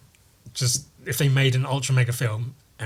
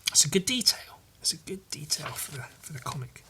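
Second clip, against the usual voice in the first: whisper; 3 semitones higher, 9 dB quieter than usual. Real, not acted. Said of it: "whispered comment".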